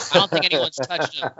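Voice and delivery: chuckling through the words; laughing. Real, not acted. Podcast host joking about Dooku, laughing. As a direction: laugh while you say it.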